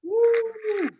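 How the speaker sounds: high whooping cheer; excited cheer. Real, not acted. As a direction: cheer the wheel landing. Spinning fitness wheel, cheering.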